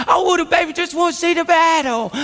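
put-on taunting baby-talk voice; mocking baby talk. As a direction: tease him as if he were a baby. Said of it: "why you gotta say it like that".

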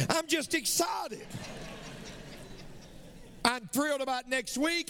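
bursting with real excitement. Real, not acted. Preacher telling the church about next week, excited shout. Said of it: "I'm all excited".

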